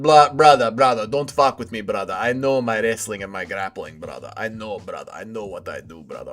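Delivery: low, muttered tough-guy impression; MMA Guru. Streamer imitates a wrestler bragging about grappling, low hushed mock menace. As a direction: drop low and quiet, sinking further with each phrase. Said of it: "an M M A Guru impression".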